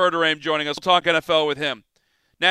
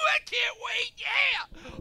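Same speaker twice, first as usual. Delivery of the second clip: very high, soft falsetto squeal; giddy anticipation; 10 dB quieter, much slower than usual. Pretend. Host hearing caller already knows his Halloween pick, giddy squeal. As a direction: squeal it softly in playful excitement.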